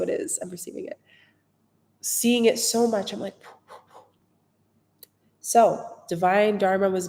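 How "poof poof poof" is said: breathy unvoiced poofs; whispered poof. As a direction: puff out the poofs like magic. Butterfly vanishing, playful sound.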